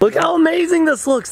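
high-pitched excited admiration. Real, not acted. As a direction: marvel at it, pitch right up. Shows off the surprise pool.

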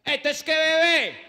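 street flirt. Retold or imitated. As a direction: holler up at the balcony. Street guy calling up.

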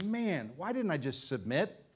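soft, rueful self-questioning; regretful looking back. Acted. Believer looking back on giving in to the devil, rueful. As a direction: quietly kick yourself over it. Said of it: "you'll look back and say".

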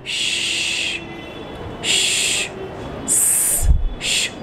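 श with curled tongue, स with flat tongue, both unvoiced; sh versus s demo. Shown as a demonstration. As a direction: contrast श and स in a hiss. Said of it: "फर्क देखिए". (श और स का फर्क दिखाते हुए)

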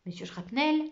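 plain reading voice

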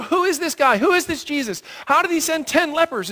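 hammed-up awe from the crowd; amazed townsfolk. Townspeople hearing of the ten lepers, amazed.

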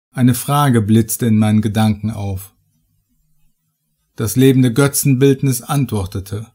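ordinary talk

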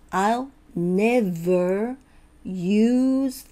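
very slow, word by word; slow practice. Shown as a demonstration. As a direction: stretch each sound for learners to check. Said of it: "ゆっくりね、". (一つ一つ確認しながらゆっくり)

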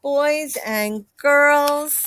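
ordinary delivery, nothing marked

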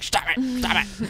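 tiny high-pitched mock tantrum; Jeff Sessions. Host mimicking the senator yelling at a colleague, high mock-angry squeak. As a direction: squeal it like a cute little man getting mad, without volume. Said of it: "when he got mad, his cute little face just got all red".

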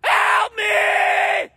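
very high, desperate scream; screaming for help. Acted. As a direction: scream high, pleading for rescue. Acted cry for help.

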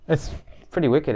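muffled behind cloth mask; speaking with mouth covered; through a face mask. Real, not acted. Seen 3: Fabric mask covers mouth and nose throughout; lights not visible.

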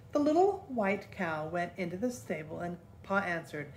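plain reading voice

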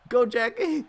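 loud high hype; hyped cheering. Real, not acted. Fan cheering for Jackie, loud and high. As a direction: cheer her on and build the tension.

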